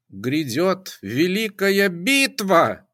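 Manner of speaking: high slow shriek. A narrator's reading. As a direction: shriek the prophecy, slow and loud. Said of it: "взвизгнула Пифия".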